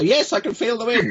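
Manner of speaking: high, lively pupil's answer; eager reply. Retold or imitated. Coach acts pupil feeling the wind, eager. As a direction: pipe up, voice leaping high. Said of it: "And you go".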